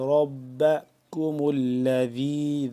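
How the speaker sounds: ربکم with a thick, full را; heavy ra. Shown as a demonstration. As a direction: open the mouth wide on the را of ربکم, chanted slowly. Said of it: "ذال یا زیر وی".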